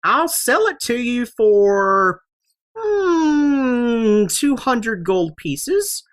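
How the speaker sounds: put-on high old woman, still giggling; old lady after laughing. Alchemist haggles with the mouse, high old lady voice. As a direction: bargain like a cackling old crone. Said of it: "It's like".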